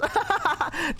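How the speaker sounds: high burst of giggling; acted high-pitched laughter; imitated genuine laugh. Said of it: "like, a genuine laugh".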